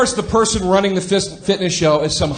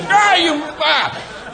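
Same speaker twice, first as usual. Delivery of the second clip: sputtering frustration; 11 semitones higher, much slower than usual. Retold or imitated. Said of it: "you're like".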